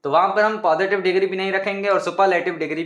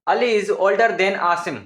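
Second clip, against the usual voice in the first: slow example sentence; much slower than usual. Shown as a demonstration. Teacher reading a model sentence to students, slow. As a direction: dictate the sentence slowly and clearly. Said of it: "मैं आपको example देता हूँ".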